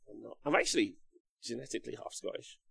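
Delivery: usual speaking voice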